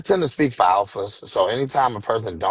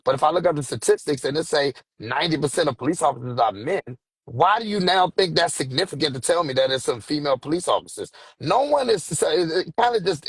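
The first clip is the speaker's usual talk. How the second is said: much higher, worked-up rebuttal; heated rant; 8 semitones higher than usual. Real, not acted. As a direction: push the argument higher as you get fired up. Guest heatedly rebutting comments.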